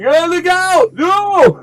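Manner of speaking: panicked scream. Acted. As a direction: yell the warning at the top of your voice. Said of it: "And he screams".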